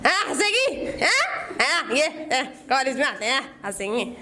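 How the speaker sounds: mild mock of a sir scoffing at laughter; a hint of a stern teacher, fading off at the end; stern teacher scolding. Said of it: "तो मैं हंसने लग गई".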